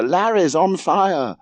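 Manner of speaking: high, drawn-out alarm cry; screamed. A narrator's reading. Sister in her brother's ear, screaming the alarm. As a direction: wail the alarm, high and slow. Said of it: "screamed Margot in his ear".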